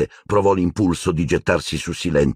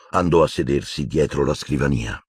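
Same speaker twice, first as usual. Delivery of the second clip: usual speaking voice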